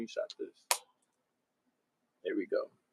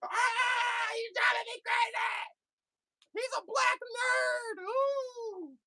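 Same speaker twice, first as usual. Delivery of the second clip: mock crybaby wail; slower than usual. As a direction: whine and cry like a sore hater. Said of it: "You're saying".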